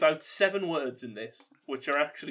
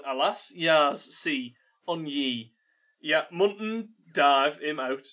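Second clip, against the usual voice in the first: halting Yorkshire read of phonetic dialect; more Yorkshire than Geordie. Acted. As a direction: give up on Geordie and labour through in Yorkshire. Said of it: "that was more Yorkshire than it was Geordie".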